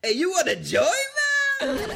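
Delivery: crackly Chicano stoner voice; Cheech Marin. Retold or imitated. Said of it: "get your voice all crackly, man".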